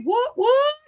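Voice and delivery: very high, quick mock surprise; playful what what. Real, not acted. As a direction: bounce up in fake surprise. Playful reaction to chat.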